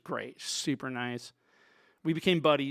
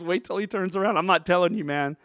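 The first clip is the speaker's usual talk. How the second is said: raised, loud playful tease; retold teasing refusal; 5 semitones higher, 7 dB louder than usual. Dave won't name the stranger, teasing. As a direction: act out a friend keeping a secret.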